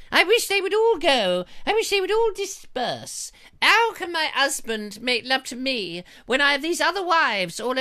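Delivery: high, regal falsetto lady; posh wife voice. Acted. Host mocks a wife from the play in high regal voice. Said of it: "excerpt there from the Merry Wives".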